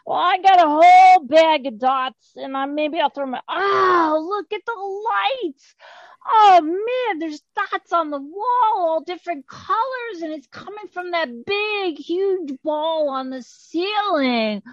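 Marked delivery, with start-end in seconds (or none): 3.5-14.6 high, drawn-out amazement ending loud; awestruck wonder. Acted. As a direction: marvel wide-eyed at a light show. Troll dazzled by lights.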